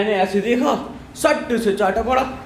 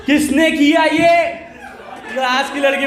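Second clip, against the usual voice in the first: furious teacher; 5 semitones higher than usual. Retold or imitated. Teacher with ink on face shouting at class, hammed-up anger. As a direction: demand it like an outraged teacher.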